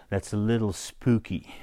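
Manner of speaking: ordinary talk, nothing marked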